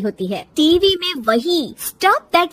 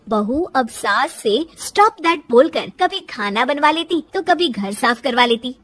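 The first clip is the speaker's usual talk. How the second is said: plain reading voice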